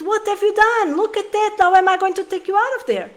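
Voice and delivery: imitating a mom yelling at her trapped child; mock panicked yelling. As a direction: act out the frantic parent's first instinct. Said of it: "start screaming at the child".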